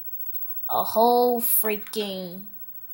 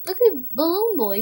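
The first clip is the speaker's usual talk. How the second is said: normal talking voice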